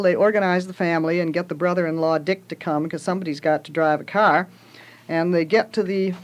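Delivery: ordinary delivery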